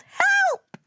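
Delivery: loud, very high cry for help; panicked yell. A narrator's reading. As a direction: yell while spinning out of control. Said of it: "all of the fast-spinning planets yelled".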